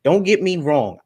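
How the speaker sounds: normal talking voice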